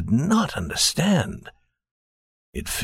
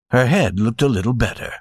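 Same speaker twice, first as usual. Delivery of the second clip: usual speaking voice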